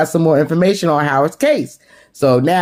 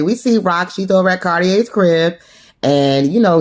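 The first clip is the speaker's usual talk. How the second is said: ordinary talk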